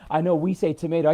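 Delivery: normal talking voice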